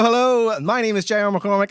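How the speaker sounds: normal talking voice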